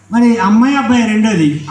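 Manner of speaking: ordinary talk, nothing marked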